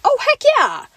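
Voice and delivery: light up at the news; thrilled kid seeing quesadilla on menu; excited cheer. Retold or imitated. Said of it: "And I was like".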